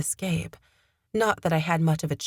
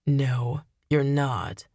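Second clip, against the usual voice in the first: cold, slightly lowered refusal; 3 semitones lower, much slower than usual. A narrator's reading. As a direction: coldly contradict the captive. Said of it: "he said in a cold voice".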